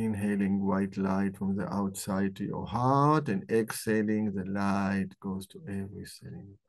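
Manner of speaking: calm guided breathing meditation. Acted. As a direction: ease them into the breath, gently.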